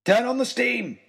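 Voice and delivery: high urgent shout. Acted. Narrator shouting to the engineer, high urgent shout. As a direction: yell the order over the noise. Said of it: "I shouted".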